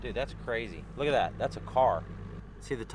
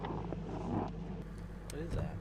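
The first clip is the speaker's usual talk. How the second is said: whispering; 3 semitones higher, 10 dB quieter, much slower than usual. Real, not acted. Spotting something odd underwater.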